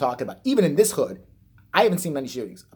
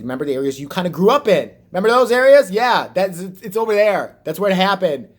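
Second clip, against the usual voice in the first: pointed, raised voice, almost shouting; louder retort; 5 semitones higher, 7 dB louder than usual. Retold or imitated. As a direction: hammer the point home loudly. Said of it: "I said".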